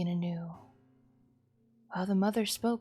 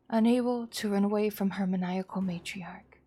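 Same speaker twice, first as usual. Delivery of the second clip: plain reading voice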